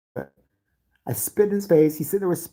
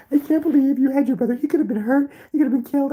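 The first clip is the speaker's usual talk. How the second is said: high, loud upset mom voice; a mother scolding her son in shock; 11 semitones higher, 4 dB louder than usual. Retold or imitated. Mother scolding about brother.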